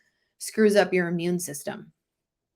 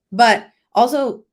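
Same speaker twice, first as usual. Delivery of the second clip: ordinary talk, nothing marked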